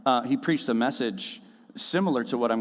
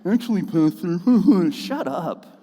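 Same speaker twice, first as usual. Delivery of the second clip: know-it-all congregant; 5 semitones higher, 4 dB louder, much slower than usual. Retold or imitated. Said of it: "I'm gonna be like".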